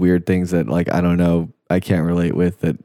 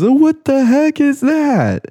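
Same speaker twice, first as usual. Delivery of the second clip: very high, drawn-out mock scream; imitated scream; 3 dB louder, slower than usual. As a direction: shriek it slowly like an overreacting YouTuber. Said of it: "And he just screams".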